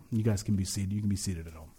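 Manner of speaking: low, soft housekeeping aside; quiet aside. Real, not acted. Pastor tells congregation to sit, quiet low aside. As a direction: drop the voice right down.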